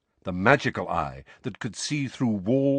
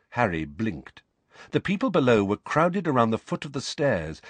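ordinary delivery, nothing marked

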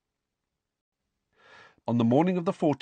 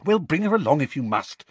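urgent; 6 semitones higher than usual. Acted. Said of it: "said Hermione urgently".

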